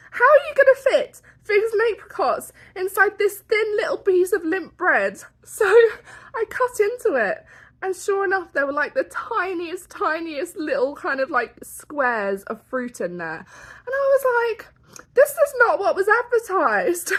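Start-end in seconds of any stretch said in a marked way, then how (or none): 0.1-5.2 high, loud disbelieving question; outraged mock disbelief; incredulous retort. Retold indignant question to waiter. 5.7-6.3 loud high laugh; sudden real laugh; burst of laughter. Real, not acted. Laughing at own story. 15.1-17.1 indignant complaint. Retold or imitated. Retold complaint at the food.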